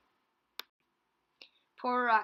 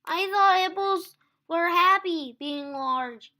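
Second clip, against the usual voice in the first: high, loud chirpy little voice; Teeny's voice; 10 semitones higher, 15 dB louder than usual. A narrator's reading. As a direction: squeak it out brightly. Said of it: "said Teeny".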